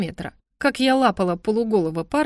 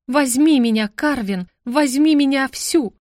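ardent cry, higher and louder; passionate shout; 5 semitones higher, 4 dB louder, slower than usual. Acted. Heroine giving the spell full passion, shouting. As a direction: cry it out with all your heart. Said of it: "Пылко, с жаром я прокричала".

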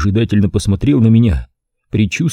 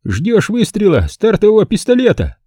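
higher, teasing boss; joking; 12 semitones higher than usual. A narrator's reading. The boss teasing his idle butler, joking. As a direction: rib the butler playfully. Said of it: "шутливо спросил его босс".

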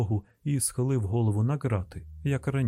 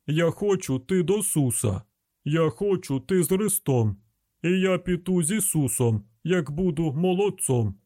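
roaring; 6 semitones higher than usual. Acted. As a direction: roar it through the bars. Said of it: "загримів голос Семі, глибокий, як ревіння леопарда".

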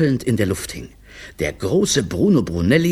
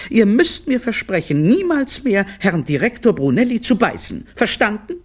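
high-pitched, admonishing mum; stern mother's high lecture; scolding mother; 12 semitones higher, 3 dB louder than usual. Acted. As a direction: lay down the law in a high voice. Mother admonishes her children.

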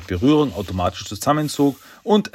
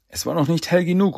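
ordinary delivery, nothing marked

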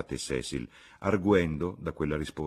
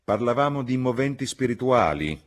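higher, louder correction; irritated; 8 semitones higher, 8 dB louder than usual. A narrator's reading. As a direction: put out at being interrupted. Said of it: "urtato dall'interruzione".

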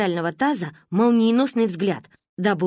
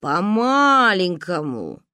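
very slow, louder drawl; 4 dB louder, much slower than usual. Acted. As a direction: stretch it out doubtfully. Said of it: "протянул папа".

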